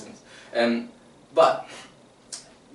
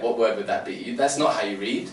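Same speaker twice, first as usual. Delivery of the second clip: usual speaking voice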